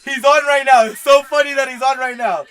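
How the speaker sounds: loud excited outburst. Real, not acted. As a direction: burst out thrilled at the screen. Excited at video.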